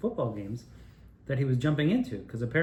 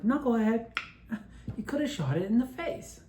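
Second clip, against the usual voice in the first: a higher, scolding imitation of dad; mock scolding dad; 10 semitones higher than usual. Dad scolding his son over a missed shot, higher mock voice. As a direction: pitch it up like a dad calling you a knucklehead.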